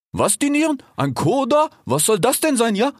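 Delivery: high, slangy incredulous questions; baffled cheetah. A narrator's reading. Cheetah questions the fox's fancy word, high bafflement.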